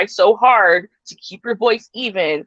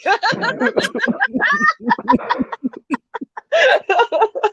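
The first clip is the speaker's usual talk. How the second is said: squealing laughter that breaks up; high shrieking laugh; 14 semitones higher than usual. Real, not acted. Laughing hard at the friend's fatty joke. Laughing after the mocking repeat.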